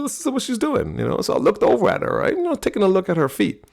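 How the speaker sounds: normal talking voice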